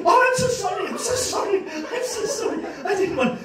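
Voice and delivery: mock apology, high and loud with laughter; apologetic boy. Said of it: "And then he".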